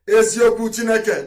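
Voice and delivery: Eziokwu Chineke cried out high and loud; loud oath. Shown as a demonstration. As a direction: proclaim Eziokwu Chineke like a vow. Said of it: "repeated as emphatic oath".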